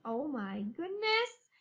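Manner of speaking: normal talking voice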